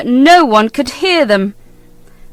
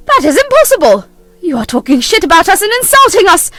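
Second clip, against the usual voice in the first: indignant; 5 semitones higher, 4 dB louder than usual. Acted. Pauline accuses Stella back.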